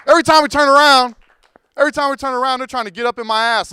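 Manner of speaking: loud, high, exasperated outburst; angry rant. Real, not acted. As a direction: let the frustration burst out.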